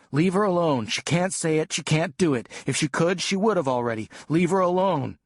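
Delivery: put-on boy yelling to protect his sister; desperate yell.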